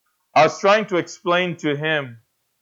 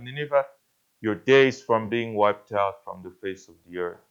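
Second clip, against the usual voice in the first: God's low judgment; 8 semitones lower, 5 dB quieter than usual. Retold or imitated. God voiced pronouncing doom.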